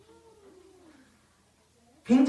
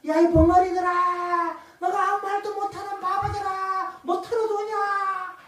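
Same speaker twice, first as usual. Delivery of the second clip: high, drawn-out taunting calls; mocking jeer; 6 semitones higher, slower than usual. Jericho's people taunting the silent marchers, mocking jeer. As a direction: jeer down at them, stretching out each line's end. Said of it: "조롱하고 비웃기 시작하지 않았겠습니까".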